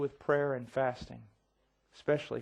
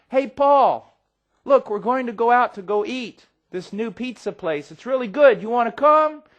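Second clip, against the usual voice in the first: much higher, loud bright call; cheery invitation; 7 semitones higher, 10 dB louder than usual. Retold or imitated. Friend driving by calls to Paul, loud and high. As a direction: sing out the invite like a pal.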